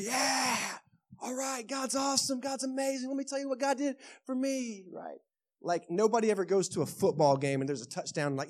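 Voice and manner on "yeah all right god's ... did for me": fan yell. Retold or imitated.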